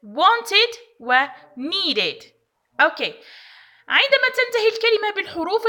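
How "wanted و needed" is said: "Wanted" and "needed" drawn out very slowly with a full "-ed" syllable; ed pronounced as id. Shown as a demonstration. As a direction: stretch "Wanted" and "needed", sounding the ending as "id". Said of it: "فإننا ننطقها إد".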